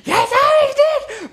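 very high, slow gleeful yell; triumphant shout. Retold or imitated. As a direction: shout it like a pitbull pouncing. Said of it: "sie so".

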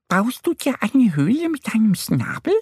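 very high, squeaky duck; high cartoon duck question; duck character voice. A narrator's reading. Duck Annie asks in story.

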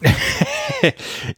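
bright burst of laughter; short laugh. Real, not acted. Laughs at sugar point.

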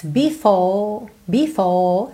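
"before" with no curled R at the end; non-rhotic British R. Shown as a demonstration. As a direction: end "before" on an open vowel, tongue down. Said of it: "sem enrolar a língua". (sem enrolar a língua em "before")

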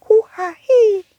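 high squeaky crying worm; a tiny creature whimpering. Acted. Worm cries again.

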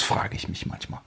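hushed, thoughtful; whispered musing. Real, not acted. Philosophical musing.

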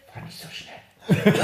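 put-on whispered slow drunk plea; whiny whisper. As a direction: breathe it out like a tipsy sister. Drunk sister pleading.

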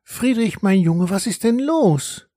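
high, slow caring mother; mother's voice. A narrator's reading. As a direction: call up the stairs like a worried mother. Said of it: "die Stimme ihrer Mutter".

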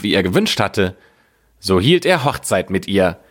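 ordinary delivery, nothing marked

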